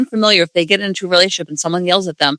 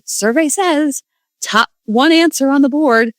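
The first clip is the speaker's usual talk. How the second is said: lightly voiced, higher game show host; 8 semitones higher, slower than usual. Host revealing the top survey answer, mock game show announcer.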